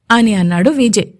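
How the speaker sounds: ordinary delivery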